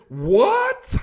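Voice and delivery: incredulous. Real, not acted. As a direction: scoff at the excuse in disbelief. Scoffing at the excuse.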